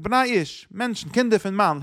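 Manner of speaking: lightly acted calling out; quoted call. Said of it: "er rief da so".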